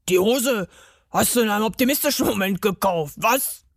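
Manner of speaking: quick, put-on mocking elf voice. A narrator's reading. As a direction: tease rapidly as a gruff little elf. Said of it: "rief sie mit tiefer Stimme". (schnelle, spöttische Elfenstimme)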